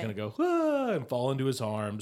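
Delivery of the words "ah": very high mock-swoon "ah"; swooning sigh. Woman falling into her suitor's arms, mock swooning. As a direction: let out a high, hammed-up lovestruck gasp.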